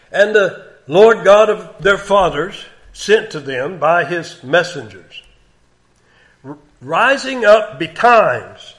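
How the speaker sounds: slow, loud, high proclamation; scripture reading. Real, not acted. Preacher reading Chronicles to the congregation, slow, ringing proclamation. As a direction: proclaim the verse slowly and forcefully.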